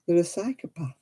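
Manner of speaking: ordinary talk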